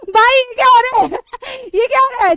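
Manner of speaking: laughing disbelief at the mangled name; laughing outcry. Real, not acted. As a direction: shout it while laughing helplessly. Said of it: "अपनी हंसी को control करती".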